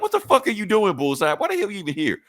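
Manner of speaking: high-pitched mock indignation; Kingpin. Acted Kingpin confronting Bullseye.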